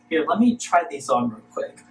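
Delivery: ordinary delivery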